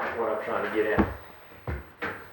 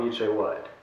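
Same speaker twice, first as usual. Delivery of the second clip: ordinary talk, nothing marked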